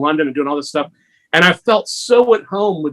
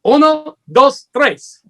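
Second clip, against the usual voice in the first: high, eager mock Spanish waiter; hammed-up Spanish accent impression; Manuel's Spanish accent; 9 semitones higher than usual. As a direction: count it out like a flustered Spanish waiter. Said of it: "He goes".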